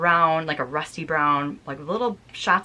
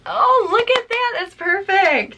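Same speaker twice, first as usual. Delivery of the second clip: delighted squeal; 14 semitones higher, 5 dB louder, slower than usual. Real, not acted. Delighted at the charm on hat.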